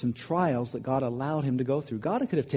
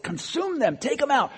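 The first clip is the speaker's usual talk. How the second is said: much higher, heated cry of rage; angry outburst; 11 semitones higher than usual. Acted. David voiced cursing Saul who hunts him, angry and high. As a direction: fling the curse out in anger. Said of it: "He is angry".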